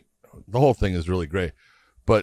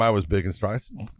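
ordinary delivery

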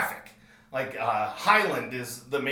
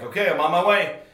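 loud, higher, sharp; urgent determined shout; 5 semitones higher, 8 dB louder, faster than usual. Retold or imitated. Telling the caller he's coming for his dog, urgent shout. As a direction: bark it, already heading out the door.

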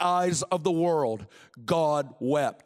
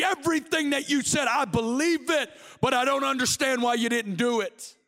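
high, pleading prayer at full force; impassioned preaching; 6 semitones higher than usual. Acted. Believer questioning God over his late arrival, impassioned pleading.

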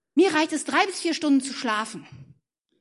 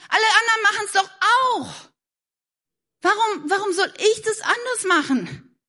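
higher, fast, defensive excuse; whiny mock excuse; 6 semitones higher than usual. Preacher mimics a friend brushing off advice, whiny and fast. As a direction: toss it off like a teen dodging blame.

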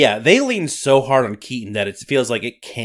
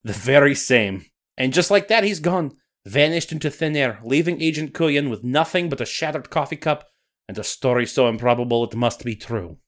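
put-on Scottish accent. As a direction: narrate like a Highland storyteller. Nessie narration.

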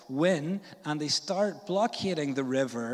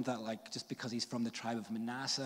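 normal talking voice

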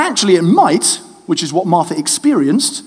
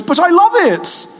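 put-on much higher happy little boy voice; guileless son; 10 semitones higher than usual. Father imitating his son protesting, a much higher child voice. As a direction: say it like a kid who means it. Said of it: "And he goes".

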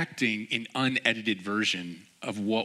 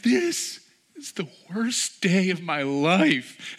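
put-on high, louder wail of a heartbroken kid; imitating a devastated child, pitched high; upset crying boy; 10 semitones higher, 5 dB louder, much slower than usual. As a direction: play the boy who just lost, on the verge of tears. Boy wails after losing game.

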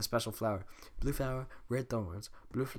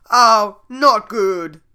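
bad voice; 10 semitones higher, 17 dB louder, much slower than usual. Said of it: "that wasn't a good voice".

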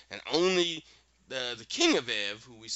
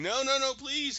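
begging; 13 semitones higher than usual. Retold or imitated. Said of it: "The wizard's begging".

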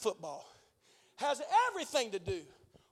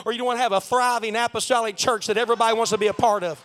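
ordinary talk

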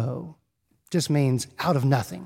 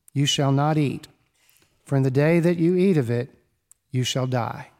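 measured, lower scripture reading voice; 4 semitones lower than usual. Acted. Preacher reading Genesis to the congregation, solemn and measured. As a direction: read the Genesis passage solemnly.

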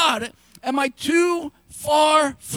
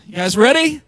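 a rousing louder call to the room; 7 dB louder than usual. Shown as a demonstration. Pastor rallying the congregation before a fast reading, louder. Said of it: "I'm talking fast now".

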